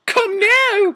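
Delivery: very high, loud falsetto order; shrieked yell. Acted. Professor ordering the students out, falsetto yell. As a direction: yell it in a hammed-up screech.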